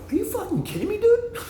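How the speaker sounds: very high squeaky disbelief through a laugh; laughing mock outrage. Retelling his comeback to the Irish friend, laughing and squeaking high. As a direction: crack up while faking outrage. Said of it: "I go".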